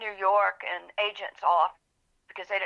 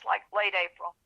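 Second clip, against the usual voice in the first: ordinary talk, nothing marked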